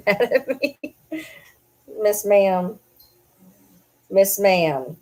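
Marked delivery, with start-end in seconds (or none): 0.1-0.9 laughing. Real, not acted. Laughing at sulking dog.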